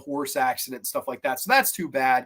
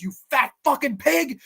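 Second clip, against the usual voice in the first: angry insult; 9 semitones higher than usual. Retold or imitated. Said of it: "Christopher Reeve just goes".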